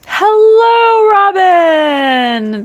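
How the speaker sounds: light up greeting a friend; excited greeting. Real, not acted. Vlogger greets viewer Robin in chat, excited and loud.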